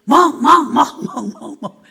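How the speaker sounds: loud barking syllables; dog bark tongues. Retold or imitated. Deaconess praying in dog-voice tongues, loud barks. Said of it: "개 목소리 방언을".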